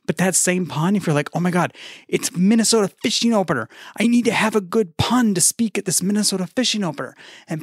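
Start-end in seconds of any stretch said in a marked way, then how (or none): 1.3-7.1 high-pitched, hammed-up excitement; mock urgency. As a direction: play someone desperate for a joke. Imagined speaker urgently needing a pun.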